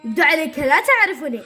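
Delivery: heated, menacing yell; angry threat. Acted. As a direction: shout it like a villain squaring up. Villain threatens to fight.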